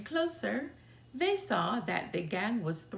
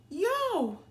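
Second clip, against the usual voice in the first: sudden yell, much higher and louder; startled yell; 11 semitones higher, 5 dB louder than usual. A narrator's reading. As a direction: yelp in alarm as the hornets come. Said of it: "yelled Too Tall".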